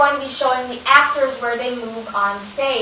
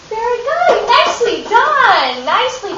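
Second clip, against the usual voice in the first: higher, bright cheering praise; excited praise; 9 semitones higher, slower than usual. Real, not acted. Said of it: "praising the class".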